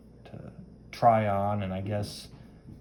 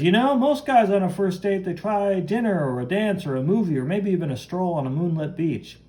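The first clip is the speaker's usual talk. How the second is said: higher, animated comic-book hero voice; breezy hero on a date; 7 semitones higher, 4 dB louder than usual. Acted. Hero boasts about first dates, animated and breezy. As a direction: play a showy date-night patter.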